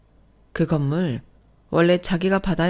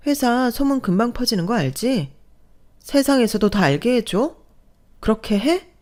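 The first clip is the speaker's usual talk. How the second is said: higher-pitched, challenging questions; heated, acted ultimatum; taunting threat; 6 semitones higher than usual. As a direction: dare them with pointed rising questions. Wife threatens to expose husband.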